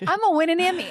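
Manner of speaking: put-on high, loud kid's proclamation; defiant childlike vow; prophesying child. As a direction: imitate a child insisting at full pitch. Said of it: "walk around prophesying that".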